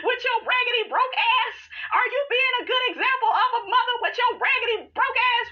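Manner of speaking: mock angry yell. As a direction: shriek the insult like a fight. Acting Simone's angry shout.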